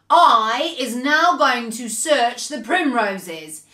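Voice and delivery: slow bullying giant voice; scornful storybook giant, slowly taunting; spouting insults. A narrator's reading. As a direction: pile up the insults, drawing out every word. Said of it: "he spouted".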